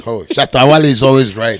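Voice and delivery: loud, laughing protest. Real, not acted. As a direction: laugh through the words, loudly.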